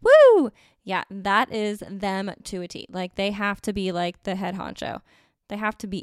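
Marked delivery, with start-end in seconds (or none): 0.0-0.5 a loud high cheering woo; loud whoop. Real, not acted. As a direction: whoop it up with a shout. Cheer after singing song.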